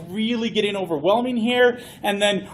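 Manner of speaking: ordinary talk, nothing marked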